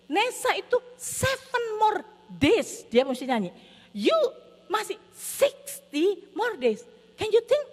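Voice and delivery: high, slow, heavily emphatic lecture; drawn-out, insistent parental emphasis; exasperated explaining. Retold or imitated. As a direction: stretch out each point for a stubborn child. Parent spells out difference to child.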